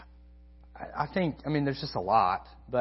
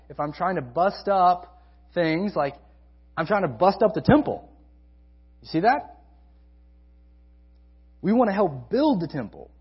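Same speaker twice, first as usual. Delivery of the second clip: louder, driving list of warnings; preaching at full force; 3 semitones higher, 4 dB louder than usual. Acted. Pastor warns the congregation against disunity, preaching hard. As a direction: press each "if I" harder, building to a challenge.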